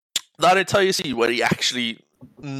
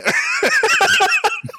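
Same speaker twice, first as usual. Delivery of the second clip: high burst of laughter; real loud cackle; big laugh; 4 dB louder than usual. Real, not acted. Laughing at his own retelling of the tackle, loud.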